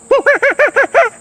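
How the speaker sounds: high squeaky monkey laugh; tickled little monkey chatter; monkey giggle. Acted. George giggles about the puppy, high monkey laugh.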